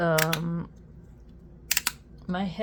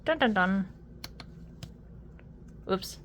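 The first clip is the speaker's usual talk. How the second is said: normal talking voice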